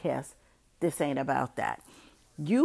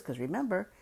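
normal talking voice

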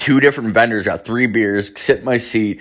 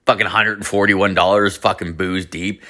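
ordinary talk

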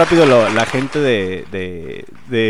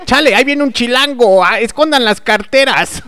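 mock chilango alarm; 10 semitones higher, 3 dB louder than usual. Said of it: "Así de".

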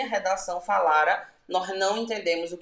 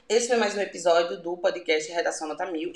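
normal talking voice

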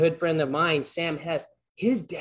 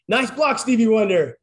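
higher, louder praise call; cheering shout; 6 semitones higher, 8 dB louder than usual. Retold or imitated. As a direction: cheer him on across the ice. Bob cheering teammate in game.

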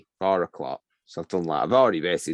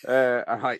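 ordinary delivery

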